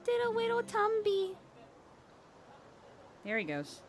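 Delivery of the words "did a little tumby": high cooing pet voice. Real, not acted. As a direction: coo it to the cat. Cooing at her cat.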